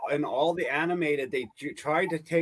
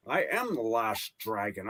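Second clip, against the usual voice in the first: Sean Connery impression; 6 semitones lower, 4 dB quieter than usual. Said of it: "There you go, Sean Connery".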